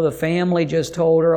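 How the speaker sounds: normal talking voice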